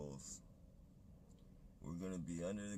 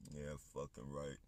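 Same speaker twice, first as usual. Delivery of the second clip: ordinary delivery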